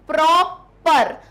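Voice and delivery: Proper split slow and loud as प्रॉ, पर; two punched beats in proper with a gap; syllable by syllable. Shown as a demonstration. Teacher breaking the word for students, syllable by syllable. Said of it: "words को तोड़ना आ रहा है".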